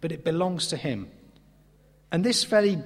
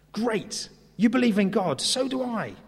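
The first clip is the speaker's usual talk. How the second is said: higher-pitched, eager common ground; enthusiastic agreement; 7 semitones higher than usual. Retold or imitated. Paul finding common ground with his listeners, enthusiastic. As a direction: retell it as a keen, welcoming agreement.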